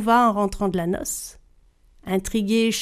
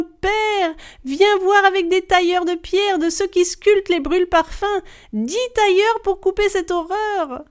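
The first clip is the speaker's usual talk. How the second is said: calling from afar; 9 semitones higher than usual. Acted. Cursed girl calling her father for help from afar. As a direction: cry out for help from far off.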